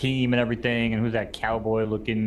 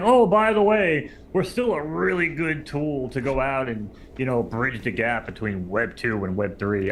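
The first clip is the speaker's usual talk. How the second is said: mocking; slower than usual. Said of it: "They're like".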